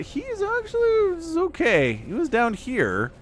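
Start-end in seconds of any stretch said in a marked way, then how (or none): 0.0-1.9 grudging surprise. Real, not acted. Grudging admission.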